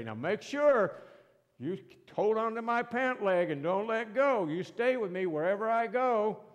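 acted parental insistence; instructing parent. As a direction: warn a small child firmly. Parent warning child at the mall.

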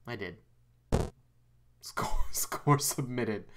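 soft, slow, laughing whisper. Real, not acted. Player submits the score after a scare, whispering slowly. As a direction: breathe it out, half laughing.

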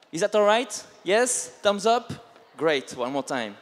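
ordinary talk, nothing marked

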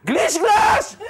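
very high, loud gleeful yell; kids shouting. Retold or imitated. Children sliding on the spilled soup, shouting. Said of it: "שומע את המאחורה צועקים".

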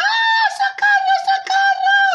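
very high, loud cry for help; panicked scream. Acted. Grandmother fleeing the wolf, screaming in high panic. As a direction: shriek for help in hammed-up terror.

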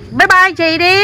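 high, loud bye-bye; calling out goodbye. Real, not acted. Parent prompts child to say goodbye, calling out. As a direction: call the farewell out high and loud.

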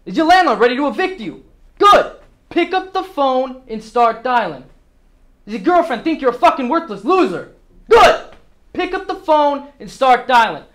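loud, high-pitched drill with explosive one-word answers; forceful boss shouting the sales floor into action; fired-up rallying. Acted.

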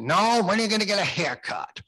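high imitation of a New England accent; David's New England accent. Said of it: "in his inimicable, uh, New England accent".

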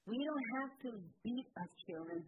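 usual speaking voice

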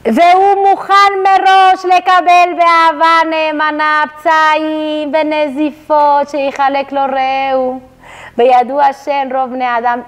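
lightly chanted Hebrew reading; reading sacred text. Acted. Teacher reads the Hebrew source aloud to the women.

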